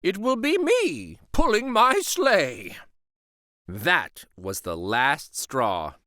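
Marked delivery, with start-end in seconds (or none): 0.0-2.8 high, lightly put-on storybook voice; a gentle high character voice; voiced higher as a friendly storybook figure; story figure voice. A narrator's reading.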